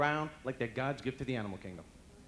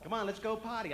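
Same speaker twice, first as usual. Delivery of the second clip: high mock Boston accent; 9 semitones higher, 4 dB louder than usual. Boston friend urging him out, mock Boston accent. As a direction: coax in an imitated Boston accent. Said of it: "Well, they talk like that".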